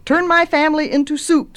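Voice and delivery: put-on high, loud little-girl demand. A narrator's reading. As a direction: order it like an insistent child. Girl demands a witch's spell.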